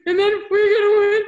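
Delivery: very high, loud mock outburst; worked-up retold answer. Athlete answering the psych's prodding, worked-up mock outburst. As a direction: blurt it out high and loud, imitating your upset self.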